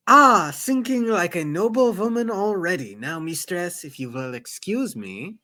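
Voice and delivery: put-on accented, knowing remark, dropping to a polite exit; courteous accented mentor, amused then excusing himself; formal steward with accent. Sazed teasing his mistress before leaving, accented and courtly.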